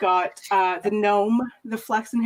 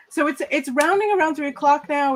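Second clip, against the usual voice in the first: ordinary delivery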